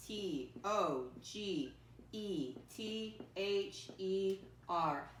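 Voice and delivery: very slow, letter by letter; spelling aloud. Shown as a demonstration. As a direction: spell it out with long gaps for pupils. Spelling a word for pupils.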